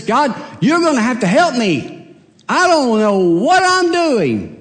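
high-pitched, stretched-out preacher delivery; fervent pulpit voice, pitch soaring; impassioned preaching. Acted. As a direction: preach it high, drawing out the key words. Preaches with raised intensity.

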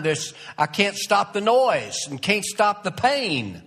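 preacher pleading the psalmist's anguish; impassioned preaching. Acted. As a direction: preach it with mounting urgency. Preaching builds in intensity.

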